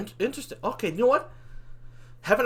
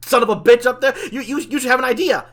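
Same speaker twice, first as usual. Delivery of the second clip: loud, higher-pitched worked-up rant; agitated rant; 5 semitones higher, 5 dB louder than usual. Acted. Demon railing at his angel friend before the war, agitated rant. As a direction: rant it at full volume, worked up.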